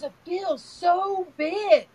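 put-on high, drawn-out child's wonder; imitating grandson. Six-year-old telling Nana what he feels, imitated slow wonder. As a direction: stretch it out like an awed little boy. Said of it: "He goes".

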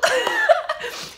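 high, loud, helpless real laughter; loud cackling laugh. Real, not acted. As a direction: burst out laughing uncontrollably. Laughs at her own imitation.

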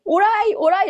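high, loud directing call; mock parking-attendant holler; imitated guiding calls. Woman guiding the car, imitated.

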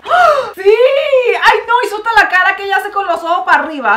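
high, loud, fast burst of delight; excited shriek. Real, not acted. Vlogger spotting Bella's signature face, excited shriek. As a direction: shriek it, thrilled by the likeness.

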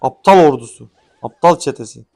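loud, scornful name-calling; angry insult. Real, not acted. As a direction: spit the insult out. Insulting own troops.

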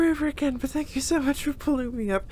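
normal talking voice

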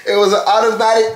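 explaining the prank between laughs; giggling admission; laughing through words. Real, not acted. Laughing while revealing prank.

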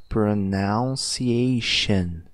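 pronunciation very slow, starting like pronoun; slow correct model. Shown as a demonstration. Teacher modeling the right form, very slow. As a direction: spell pronunciation out in sound, slowly. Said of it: "Nosso pronome é o nosso pronoun".

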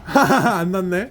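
laughing. Real, not acted. Laughing at the taste test.

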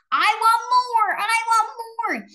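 very high, loud demanding chant; greedy child. Acted.

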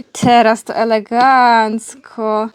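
slow, savoured praise of the trick; slow drawn-out praise. Real, not acted. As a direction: stretch every syllable of elegancko slowly. Praising dog's paw trick again.

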